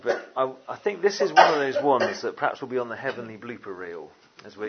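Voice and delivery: ordinary talk, nothing marked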